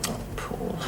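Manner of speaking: tender whisper. Acted. Tender sympathy at his bedside.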